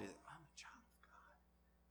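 slow, breathless hushed whisper. Acted. Preacher whispering his wonder to the congregation. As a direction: whisper it like a secret.

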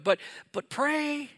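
hammed-up teen whine; teenage dread. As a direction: squeak out the dreaded word like a cornered kid. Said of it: "And I thought".

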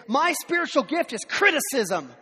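hammed-up self-righteous pride; mock proud boast. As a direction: brag about criticism like a virtue.

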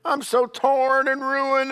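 mock miserable pleading to the Lord; whiny self-pity. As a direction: pity yourself, mockingly. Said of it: "mock self-pitying prayer".

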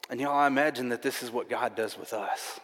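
ordinary delivery

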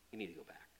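quiet angel voice. Acted. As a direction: break it to Lazarus softly. Angel speaks to Lazarus.

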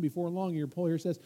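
ordinary talk

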